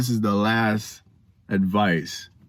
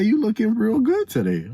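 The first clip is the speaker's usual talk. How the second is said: high-pitched imitation of an admirer; flirting lady; 12 semitones higher than usual. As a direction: coo a compliment in a put-on high voice. Voicing imagined beautiful lady flirting.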